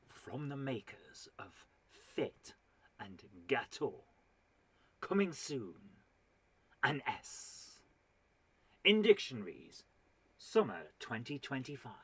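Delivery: mock trailer voiceover with dramatic pauses; film trailer voice. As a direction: tease it like a coming-soon announcement. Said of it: "film trailers and has decided".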